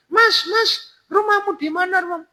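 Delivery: very high falsetto woman; high put-on female voice; woman's voice. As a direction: pitch way up as the woman answering. Voicing the woman in the story.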